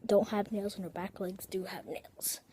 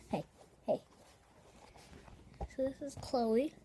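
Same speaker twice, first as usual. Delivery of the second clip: ordinary talk, nothing marked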